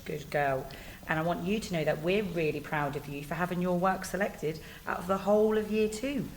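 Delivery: plain reading voice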